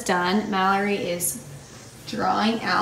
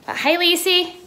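cheery greeting; 9 semitones higher, 6 dB louder than usual. Real, not acted. Greeting someone arriving.

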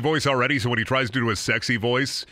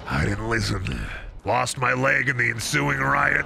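normal talking voice

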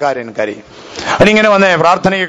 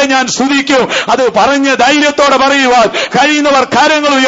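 rising, higher preaching voice building to a call; fervent preaching; 8 semitones higher than usual. Acted. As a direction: preach it with growing fire. Preaching Joseph's story, praise. (ആവേശത്തോടെയുള്ള പ്രസംഗം)

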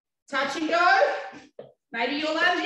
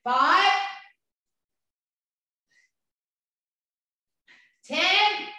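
calling out; 3 semitones higher, 5 dB louder, much slower than usual. Real, not acted. Calling out reps mid-workout.

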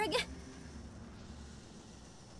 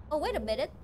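ordinary talk

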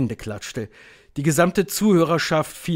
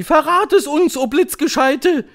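high, loud eager plea; raised, urgent crowd cry; calling crowd; 8 dB louder than usual. A narrator's reading. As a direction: shout it as a begging audience. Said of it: "riefen sie".

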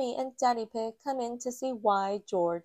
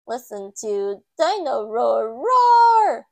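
loud, slow mechanical toy voice; talking toy waking the children, loud and slow; toy dinosaur; 2 semitones higher, 11 dB louder, slower than usual. A narrator's reading. Toy wakes up at night.

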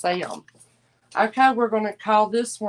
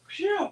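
high exhaled phew; 12 semitones higher, 3 dB quieter than usual. Real, not acted. Host overheated from a hot flash, puffing out air. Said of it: "I'm having a bad hot flash".

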